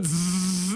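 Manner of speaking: long droning zzz of a buzzer; imitated buzz. Teller imitating the gym scoreclock's constant noise, droning buzz. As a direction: drone a steady electrical buzz. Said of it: "all you can hear is a".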